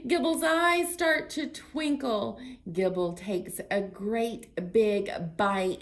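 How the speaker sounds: loud, lively picture-book read-aloud; reading to children. Acted. Librarian reading a story to children, lively. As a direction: read it big and bright for little ones.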